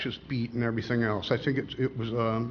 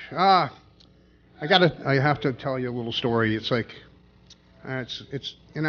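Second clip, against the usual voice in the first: usual speaking voice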